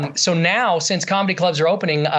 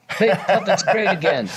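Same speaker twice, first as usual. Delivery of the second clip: Donald Trump impression; 3 semitones higher than usual. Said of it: "do that New York accent".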